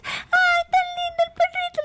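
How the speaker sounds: very high, gushy pet-talk voice; squeaky-high doggy baby talk; cooing at a dog. Retold or imitated. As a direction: imitate someone gushing over a puppy. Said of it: "porque tú le dices".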